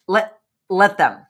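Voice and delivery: slow, loud, emphatic let them. Shown as a demonstration. Host stating her let-them motto, slow and emphatic. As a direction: lean hard on let, let them. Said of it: "that's in that let them theory".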